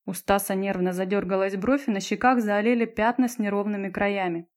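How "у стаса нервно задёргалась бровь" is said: ordinary delivery, nothing marked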